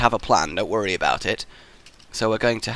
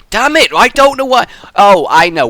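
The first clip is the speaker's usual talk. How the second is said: high, loud burst of frustration; exasperated outburst; 9 semitones higher, 11 dB louder than usual. Real, not acted. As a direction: suddenly yell it in exasperation. Sudden outburst while building the trap.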